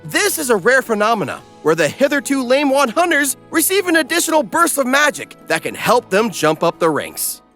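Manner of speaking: ordinary delivery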